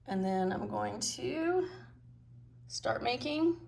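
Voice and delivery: ordinary delivery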